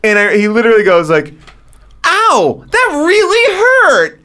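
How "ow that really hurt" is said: high, drawn-out mock wail; mock whining baby. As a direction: complain like a hurt baby, hammed up. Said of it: "complaining like a baby".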